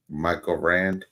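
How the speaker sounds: ordinary delivery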